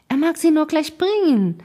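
called out high and loud; an impatient, spoilt girl's call to bring it now; high, loud, eager demand. A narrator's reading. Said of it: "rief Lucian".

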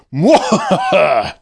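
slow hammy villain laugh; evil cackle. Acted. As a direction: cackle like a panto baddie. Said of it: "Doctor Dirt laughed".

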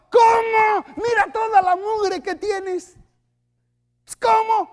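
high, slow incredulous cry. Acted. Pastor reacting to a complaining believer, incredulous. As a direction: gasp in disbelief at the filth.

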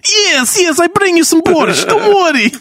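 high, hammed-up Russian mother; Russian mother accent. Mother promising her son borscht, high mock Russian accent. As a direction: fuss over your son in mock Russian. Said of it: "had, like, a Russian accent".

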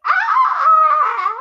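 long, very high, loud shriek; mock bloody-murder scream. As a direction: imitate a giggle that sounds like screaming. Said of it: "like, screaming bloody murder".

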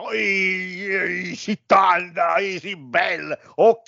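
high, drawn-out Neapolitan come-on; neapolitan accent. Retold or imitated. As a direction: ham up a Neapolitan flirt. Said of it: "E Siani, quando la, la Leotta".

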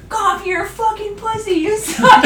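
high, drawn-out mock jeer; imitated heckling. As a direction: heckle like a crowd, half laughing. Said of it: "everyone was just shitting on".

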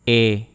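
E said as a French closed "ay" without glide. Shown as a demonstration. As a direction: say E as a clear, held French é. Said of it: "Try to imitate my pronunciation".

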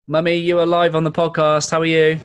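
loud, high, bright greeting; loud phone greeting. Real, not acted. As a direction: call out cheerfully over the phone. Calling Mummy on speakerphone.

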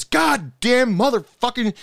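high, loud imitation of a furious coworker swearing; angry cursing. Coworker cursing when a keg pops, imitated furious swearing. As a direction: blow up like the keg just popped on your shift. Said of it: "He would be so angry".

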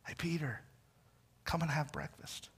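Jesus softly calling Peter to breakfast; gentle invitation. Retold or imitated. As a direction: invite him in quietly, like a friend.

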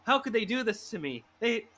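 high-pitched indignant rant; mock anger. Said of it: "I turned to anger".

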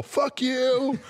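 high-pitched jeering call; taunting mock yell. Kids on the roof to the angry driver, high mock yell.

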